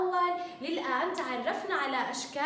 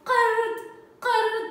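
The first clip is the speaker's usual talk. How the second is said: قرد said higher and louder, qaf punched; stressed qaf; 6 semitones higher, 7 dB louder than usual. Shown as a demonstration. Teacher stressing qaf for pupils, higher and louder. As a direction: sing out the word with a crisp qaf. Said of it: "أنطق بها بصوت القاف".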